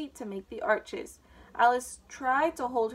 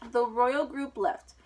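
usual speaking voice

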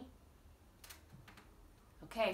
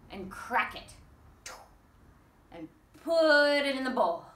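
lively, sing-song storybook reading; reading to children; 3 dB louder than usual. Acted. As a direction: read it out bouncy for little ones. Reads picture book to toddlers.

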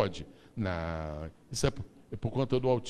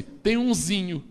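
put-on Carioca accent; 11 semitones higher, 4 dB louder than usual. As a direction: play a Rio local, casual. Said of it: "o carioca diz assim".

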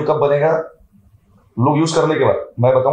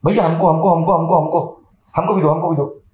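higher, repetitive eager pleading; clamouring customers; 3 semitones higher than usual. Retold or imitated. Customers clamour for the product, imitated in eager pleading. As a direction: imitate a crowd begging for a share.

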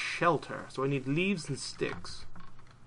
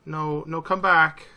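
shouting after it; calling out; 3 semitones higher, 8 dB louder, slower than usual. Real, not acted. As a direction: call it back louder each time. Calling after a fleeing creature.

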